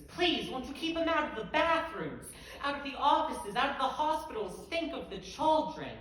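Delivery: high, loud pleading voice of a scandalized townsperson; mock moral panic. Poet mocking a panicked mob, high and loud pleading. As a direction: ham up the clutching-pearls plea. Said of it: "they've got pitchforks and torches".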